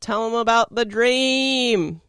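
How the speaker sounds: loud, high, drawn-out yell. Retold or imitated. Friend yells out to the speaker mid-speech, imitated loud yell. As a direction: call it out across a crowd, stretched and high. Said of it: "[name] yells out".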